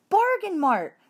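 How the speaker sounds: eager blurt. A narrator's reading. Richie's answer makes class laugh.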